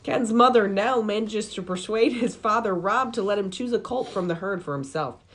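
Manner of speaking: announcer-style plot summary; synopsis read-aloud. Acted. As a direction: read it like a film blurb, building to the question. Reads out a film synopsis.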